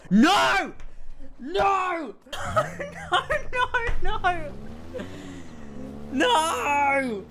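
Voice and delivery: high, anguished yelling of no. Real, not acted. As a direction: cry out in disbelief, very high. Sprint appears in reveal.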